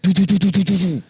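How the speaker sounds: grenade launcher noise. Pretend. Friend in the army firing grenade launchers. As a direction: rattle off pretend gunfire. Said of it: "I'd be like".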